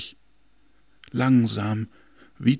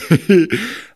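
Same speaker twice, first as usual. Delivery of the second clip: loud scornful laugh; wind's mocking laugh; 8 semitones higher, 8 dB louder than usual. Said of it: "es ist zum Totlachen".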